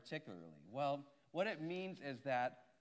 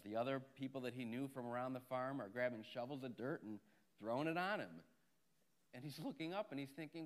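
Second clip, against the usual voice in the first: ordinary delivery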